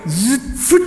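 laughing spitting sound; mock toss noise with laugh. Daughter tossing the cake, a laughing spit sound. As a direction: blow it out through a laugh.

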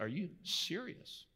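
hushed, slightly breaking question; voice cracking. Retold or imitated. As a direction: let the voice catch a little as she asks. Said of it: "There's a little cracking in her voice".

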